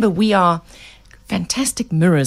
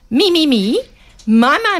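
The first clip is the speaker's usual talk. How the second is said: put-on higher, louder whiny kid voice; selfish child; 8 semitones higher, 4 dB louder than usual. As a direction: mimic a kid demanding everything. Mimicking self-centred kids.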